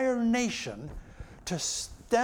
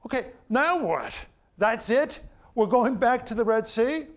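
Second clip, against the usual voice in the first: louder, exasperated protest; grumbling complaint; 6 semitones higher, 5 dB louder than usual. Retold or imitated. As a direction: throw up your hands at the plan. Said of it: "They grumbled, said".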